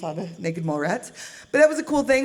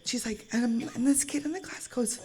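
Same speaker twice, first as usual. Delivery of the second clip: normal talking voice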